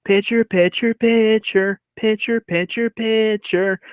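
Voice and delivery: high, drawn-out heckling chant; taunting. Retold or imitated. Henry taunting the pitcher from first base, taunting singsong. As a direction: needle him with each repeated word. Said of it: "is taunting him and goes".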